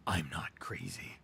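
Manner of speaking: low breathy whisper. Acted. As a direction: whisper it to yourself. Repeated denial, whispered.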